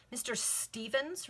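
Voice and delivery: slow, questioning echo of the name; drawn-out query, rising at the end; incredulous repeat. A narrator's reading. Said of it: "repeated Eliza".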